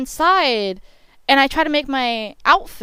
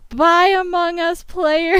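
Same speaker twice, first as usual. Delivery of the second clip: put-on higher, slow, cute child's goodbye; little girl; 7 semitones higher, 5 dB louder, slower than usual. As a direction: wave goodbye like a small kid. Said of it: "there's a little girl".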